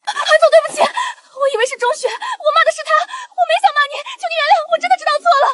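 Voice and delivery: high-pitched, frantic apology; panicked pleading. Acted. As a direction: beg for mercy in a high, desperate voice. Begging powerful man for forgiveness. (惊慌失措地高声求饶)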